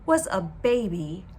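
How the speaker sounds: ordinary delivery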